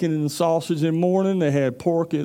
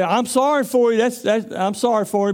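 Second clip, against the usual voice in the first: mock sympathy; 6 semitones higher, 3 dB louder than usual. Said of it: "Some people gonna say".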